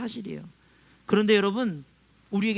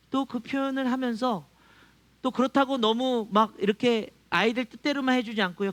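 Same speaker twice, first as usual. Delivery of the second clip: usual speaking voice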